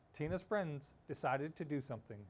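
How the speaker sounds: usual speaking voice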